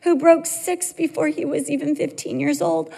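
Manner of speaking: high, loud, near-crying complaint; mock tearful rant. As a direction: wail it out, half crying, half scolding. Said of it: "I could cry and say".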